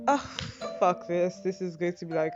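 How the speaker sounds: usual speaking voice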